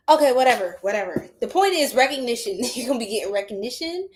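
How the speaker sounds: ordinary talk, nothing marked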